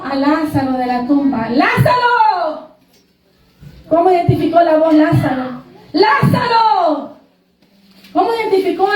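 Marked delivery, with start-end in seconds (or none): 1.5-2.7 long drawn-out call, higher pitch. Acted. Preacher voicing Jesus calling Lazarus from the tomb, calling out. 5.9-7.2 long drawn-out call, higher pitch. Acted. Preacher voicing Jesus calling Lazarus from the tomb, calling out.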